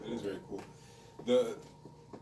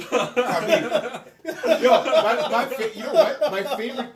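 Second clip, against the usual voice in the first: high and loud through laughter; excited, laughing outburst; 11 semitones higher, 9 dB louder than usual. Real, not acted. Laughing, bantering loudly.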